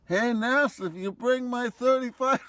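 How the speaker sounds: mock yell across the fences; high, excited mock call to an old friend; retold yell. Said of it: "I hear this voice yell out".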